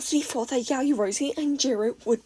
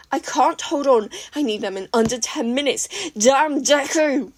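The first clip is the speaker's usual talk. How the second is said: furious shout; 3 semitones higher, 6 dB louder than usual. A narrator's reading. Katsuki raging at Izuku, shouting. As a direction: rage at Deku.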